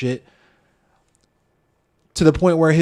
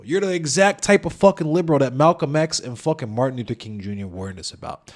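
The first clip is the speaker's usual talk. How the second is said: ordinary talk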